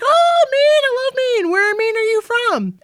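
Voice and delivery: held high and loud at first, mimicking sudden enthusiasm; piping right up. Woman mimics the star piping up about Maine, high and excited. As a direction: imitate a very high, excited exclamation. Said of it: "pipes right up".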